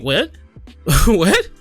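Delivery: usual speaking voice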